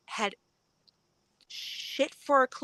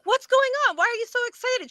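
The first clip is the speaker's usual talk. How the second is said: confused surprise; 13 semitones higher, 4 dB louder, faster than usual. Retold or imitated. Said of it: "And I'm like".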